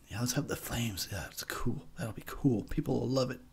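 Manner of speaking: hushed mocking producer; mock whisper. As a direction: whisper it like a scheming filmmaker.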